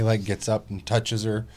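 usual speaking voice

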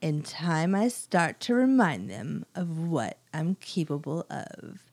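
low, slow, hushed menace in a put-on accent; scary old lady killer. Old lady killer plotting to remind them, low menace. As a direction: sink into a soft, deliberate villain's threat. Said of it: "she's a scary old lady killer".

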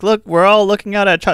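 a light, high-pitched go at an announcer impression; half-hearted sportscaster impression, voice lifted; Vin Scully. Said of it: "this is my worst Vin Scully impression".

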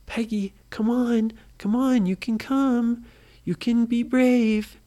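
high, coaxing call down the hole. A narrator's reading. Adults coaxing scared Peggy down the hole, high and coaxing.

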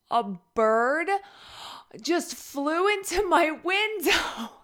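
high, loud and drawn out, on the edge of laughing; real startled giggle creeping in; breaking into laughter. Real, not acted. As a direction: let laughter rise as you get it out. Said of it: "Why am I laughing".

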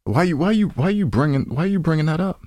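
ordinary delivery

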